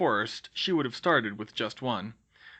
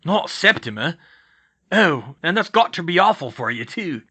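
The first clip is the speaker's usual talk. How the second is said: loud, higher rustic voice; aghast, then sympathetic; Hagrid, dismayed; 5 semitones higher, 9 dB louder than usual. Acted. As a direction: lament and sympathise as a big-hearted gamekeeper.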